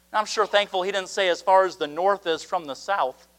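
ordinary talk, nothing marked

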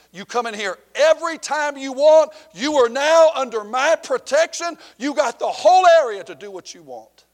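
high, loud declaration of protection; loud proclamation. Retold or imitated. Gang leader grants the preacher protection, loud proud declaration. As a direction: announce it like a boss granting favour.

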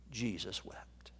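"Jesus wept" breathed in a whisper, no voice. Real, not acted. Preacher to grieving congregation, whispering the verse.

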